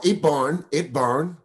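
lightly mimicked Chinese accent; caricatured Chinese-accented English; mock Chinese accent. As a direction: put on a rough Chinese accent, each word lifted at the end. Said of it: "versus the Chinese where they go".

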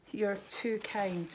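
ordinary talk, nothing marked

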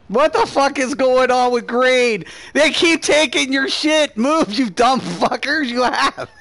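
high frustrated shouting at the team; angry yelling. Real, not acted. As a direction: yell at teammates in disbelief. Frustrated at teammates in fight.

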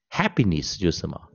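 ordinary delivery

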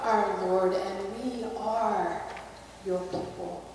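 soft, slow, hushed prayer voice. Acted. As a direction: pray quietly to God. Pastor closing an opening prayer.

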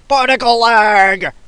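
slow, drawn-out high shout of particle lag; catchphrase shout. Pretend. Gamer yelling his catchphrase at the lag, drawn-out shout. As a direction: bellow it like a battle cry.

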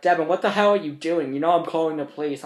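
put-on group home staff catching a teen setting a fire; retold staff yell. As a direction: scold like shocked staff. Staff yelling at him.

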